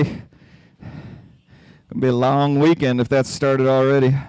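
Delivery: ordinary talk, nothing marked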